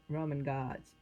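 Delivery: usual speaking voice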